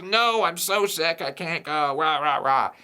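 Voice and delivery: put-on whiny sick kid. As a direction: whine it out like a kid who won't go to school. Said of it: "he'll keep just".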